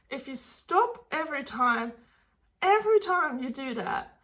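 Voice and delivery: ordinary delivery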